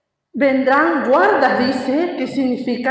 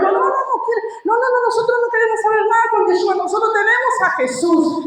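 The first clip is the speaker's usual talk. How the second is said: high, fast whiny mock refusal; mocking the brothers; 7 semitones higher, faster than usual. Preacher mimicking brothers who refuse Yeshua, high and fast. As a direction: rattle it off like a dismissive scoffer.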